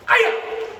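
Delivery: explosive high, loud "hi-ya"; martial arts kiai. Shown as a demonstration. Teacher demonstrates a strike after takedown, explosive kiai. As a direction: yell it sharply with the strike.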